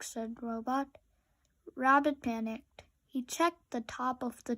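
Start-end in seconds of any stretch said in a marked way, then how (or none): none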